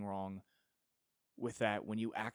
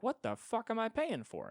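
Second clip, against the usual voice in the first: very high, outraged shriek of a question; outraged mock yell; 13 semitones higher, 4 dB louder than usual. Billionaire yelling at the model, very high and outraged. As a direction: demand it in disbelief.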